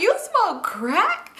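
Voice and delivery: high, slowly stretched shocked question; incredulous disbelief. Retold or imitated. Storyteller questioning man about his drug, high incredulous disbelief. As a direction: replay your astonishment, stretching it out high.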